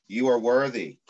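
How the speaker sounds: ordinary talk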